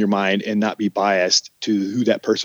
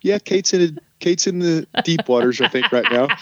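higher, wry knowing remark; note it with a raised amused voice; amused knowing aside; 7 semitones higher than usual. Retold or imitated. Manager watching Kate struggle, amused higher aside. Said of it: "I'm like".

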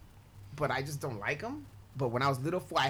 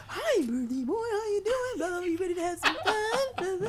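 very high, squeaky clown voice; slower than usual. Retold or imitated. Clown approaches the birthday boy, squeaky clown voice. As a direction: gush at the kid like a party clown. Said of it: "So this fool comes over".

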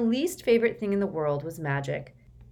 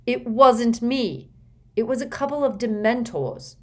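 loud, higher, exasperated denial; Harry loses patience with his uncle, loud; rising temper; 6 semitones higher, 7 dB louder than usual. Acted. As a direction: let temper and voice rise together. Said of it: "temper and voice rising together".

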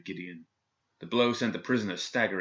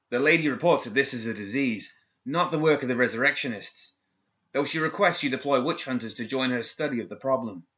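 put-on higher, hesitant young guard; nervous guard; 5 semitones higher, 4 dB louder than usual. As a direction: stammer it out anxiously.

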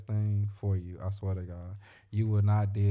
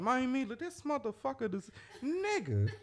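much higher, whiny complaining voice; exasperated venting; 13 semitones higher, 3 dB quieter than usual. Retold or imitated. To his best friend, venting in a high whiny voice. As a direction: squeal it out like you can't believe it. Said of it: "I be like".

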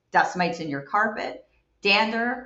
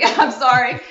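laughing apology; giggle, then say sorry; 6 semitones higher, 7 dB louder, slower than usual. Real, not acted. Laughs at the masseuse firing.

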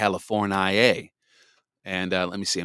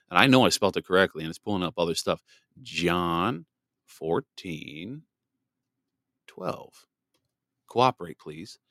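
ordinary talk

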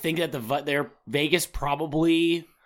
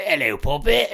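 mock Cockney; 4 dB louder than usual. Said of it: "go real Cockney on it".

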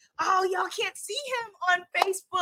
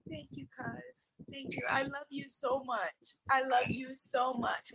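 much softer, hushed and heartfelt; tender thanks; 11 dB quieter than usual. Real, not acted. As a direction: quietly thank the cousin, deeply moved. Softly thanking cousin after praise.

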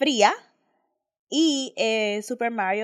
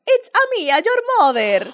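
high, louder Mario-style tease; mock Mario voice; 7 semitones higher, 7 dB louder than usual. Mother teasing her son in a Mario voice. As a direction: tease your kid like the plumber. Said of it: "jodiendo a mi hijo y digo".

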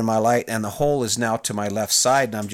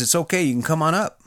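lightly voiced buddy giving the all-clear, higher pitch; radio him up in a raised, easy call; Johnny; 6 semitones higher than usual. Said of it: "Johnny on the radio again".

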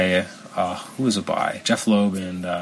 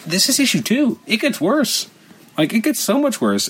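much higher, aghast reviewer voice; exasperated disbelief; 13 semitones higher than usual. Real, not acted. Reviewer warning that the second issue gets worse, exasperated disbelief. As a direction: pitch it way up, incredulous.

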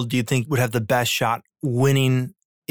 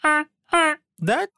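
goose honk. Pretend. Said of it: "That, that sounds".

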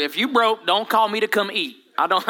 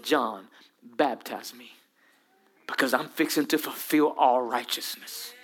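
soft low voice of Jesus; 9 semitones lower, 6 dB quieter than usual. Acted. Jesus answers John softly.

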